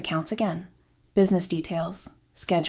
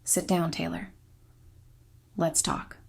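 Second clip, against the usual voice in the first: softly, a little slower, understated; quiet, controlled confrontation; 6 dB quieter than usual. A narrator's reading. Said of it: "in that quiet voice of his".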